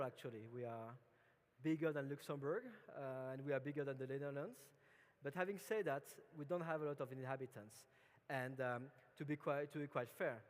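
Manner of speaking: usual speaking voice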